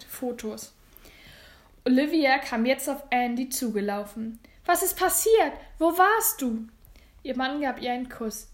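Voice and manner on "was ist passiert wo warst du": high, loud, worried questions; urgent, raised-pitch worry; alarmed questioning. Acted. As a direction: demand answers in alarm. Worried wife questions her husband.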